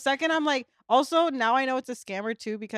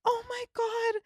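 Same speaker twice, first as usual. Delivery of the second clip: high, put-on girlish gush; 9 semitones higher than usual. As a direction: pour your heart out in a high voice. Girl gushing to scam caller.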